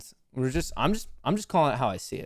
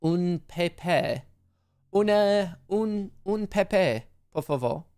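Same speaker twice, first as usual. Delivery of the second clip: hammed-up French waiter, a bit higher; mock French accent; 5 semitones higher than usual. Shown as a demonstration. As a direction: offer it like a snooty French waiter, lightly. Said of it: "say it with a little bit of, of a French accent".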